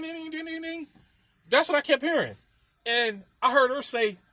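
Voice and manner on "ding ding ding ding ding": high, soft pinging of coins spilling; ping out the falling coins; imitated coin dings. Describing coins falling out in Sonic, soft high dings. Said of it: "you hear ding".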